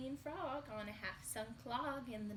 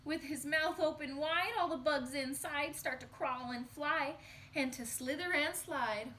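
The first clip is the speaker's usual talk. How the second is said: louder, higher, urgent reading; excited storytelling; 5 semitones higher, 6 dB louder than usual. Acted. Teacher reading the scary climax to children, loud and excited. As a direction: race through the climax for the kids.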